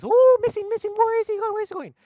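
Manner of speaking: high squealing panicked cartoon voice; loud, hammed-up falsetto cry; Mario. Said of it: "and Mario goes".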